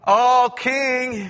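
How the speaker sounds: O king with the O held long and loud; O drawn out high and loud, up from the den. Acted. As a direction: stretch out the O as a loud relieved call. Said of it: "And that first O".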